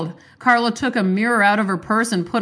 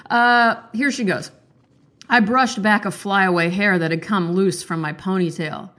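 ordinary talk

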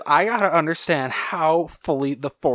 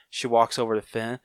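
normal talking voice

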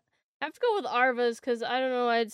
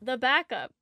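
ordinary talk

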